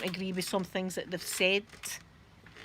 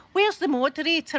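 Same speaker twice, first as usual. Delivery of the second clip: mock yell across the chat, a touch higher; retold shout; 6 semitones higher, 5 dB louder than usual. Viewer in the chat demanding the moderator, mock shout. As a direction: call out for the missing moderator. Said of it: "somebody's shouting".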